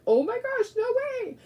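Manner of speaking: high-pitched, thrilled gasp of disbelief; excited squeal. Retold or imitated. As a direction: squeal it like the best surprise ever. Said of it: "I'm like".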